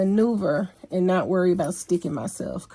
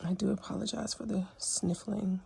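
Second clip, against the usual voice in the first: whisper; 10 dB quieter than usual. Real, not acted. Apologizing close to the camera.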